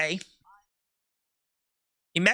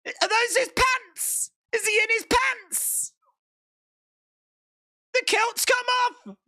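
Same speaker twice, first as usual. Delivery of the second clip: much higher, incredulous shouting; shocked; 11 semitones higher than usual. Real, not acted. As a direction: squeal in disbelief. Shocked at kilt falling off.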